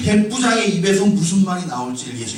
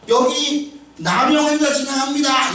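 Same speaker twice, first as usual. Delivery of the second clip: raised, drawn-out announcement; acted warning call; 6 semitones higher than usual. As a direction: call it out slowly from a distance. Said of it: "이렇게 소리를 지르지 않으면".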